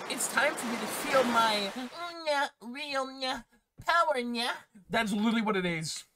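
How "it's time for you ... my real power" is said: cutesy anime catgirl voice; high, cute catgirl read; Neko girl voice. Pretend. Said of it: "I'm gonna read this line like a Neko girl".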